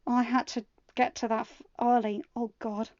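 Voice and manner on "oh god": ordinary delivery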